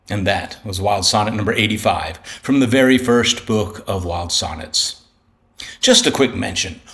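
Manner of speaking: normal talking voice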